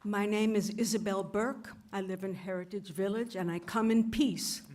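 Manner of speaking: ordinary delivery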